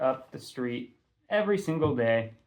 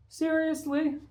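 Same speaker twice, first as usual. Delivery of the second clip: much higher whining question; a whiny disbelieving seriously; whined. Acted. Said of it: "Lou whined".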